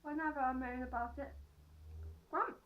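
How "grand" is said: higher, slow, puzzled echo; querying the odd phrase; disbelieving echo of the words; baffled repeat. Real, not acted. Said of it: "Grandma always trots out".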